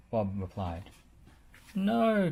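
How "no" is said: cried out. A narrator's reading. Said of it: "cried Scoop".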